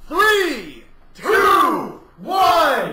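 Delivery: loud countdown shout. Real, not acted. Countdown to a drinking race.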